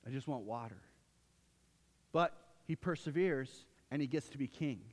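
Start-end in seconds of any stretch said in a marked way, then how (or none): 0.0-0.8 low, soft and pleading for water; soft, quieter thirsty plea; parched longing. Retold or imitated. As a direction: beg quietly, worn down. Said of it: "he's like".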